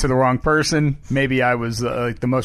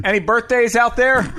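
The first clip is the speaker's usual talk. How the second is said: raised pitch, calling out to the audience; crowd-work comic; 8 semitones higher than usual. Acted. Comic asking the Broadway crowd, high showy call. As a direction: work the room like a hammy stage comedian. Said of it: "it's Broadway comedy".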